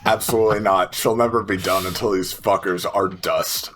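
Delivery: ordinary talk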